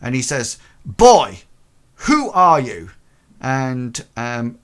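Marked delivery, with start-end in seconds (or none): 0.8-2.9 loud demanding challenge; more angry. Acted. Master confronting the intruding boy, loud and angry. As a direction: bark the question at the boy. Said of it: "a little bit more angry".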